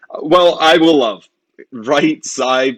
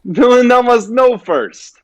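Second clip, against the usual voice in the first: emphatic; 10 semitones higher, 5 dB louder, slower than usual. Acted. Berowne insisting.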